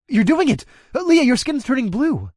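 high, loud excited outburst; excited shout. Acted. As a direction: blurt it out in amazement. Narrator sees Leah transform.

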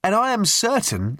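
high-pitched, insistent youth; expostulating. A narrator's reading. Youth expostulating from his deck chair, insistent. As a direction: argue it in a high, earnest voice. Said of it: "who was expostulating from his deck chair".

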